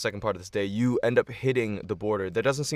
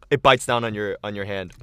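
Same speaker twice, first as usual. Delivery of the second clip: normal talking voice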